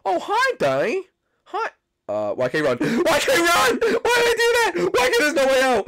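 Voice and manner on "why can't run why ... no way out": high, loud hammed-up cries of panic; mock panicked wailing. Gamer fleeing the animatronic, high hammed-up panic. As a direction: wail like a doomed victim fleeing.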